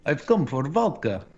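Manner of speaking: put-on heavy Russian accent, laughing. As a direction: play a Russian who wants vodka. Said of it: "I can do Russian".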